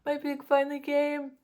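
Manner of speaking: a second, slightly calmer thrilled take; excited. Real, not acted. Vlogger repeating to viewers that her pig came, still thrilled. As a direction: still glowing with delight.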